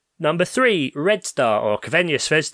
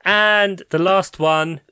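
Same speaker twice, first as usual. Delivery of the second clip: normal talking voice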